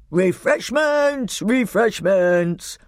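high, slow, stretched-out roaring call; old man's roar. A narrator's reading. Old man calls for food among the grapes, roaring. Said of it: "roared the old man".

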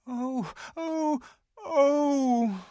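high, wailing cries of grief; anguished cry. Acted. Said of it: "cried the two girls".

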